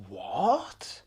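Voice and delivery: breathless whispered what; shocked whisper. Real, not acted. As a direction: whisper it in disbelief. Shocked reaction to the crying rule.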